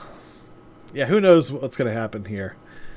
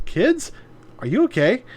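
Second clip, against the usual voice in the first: surprised; 7 semitones higher than usual. Acted. Maya confused at kids.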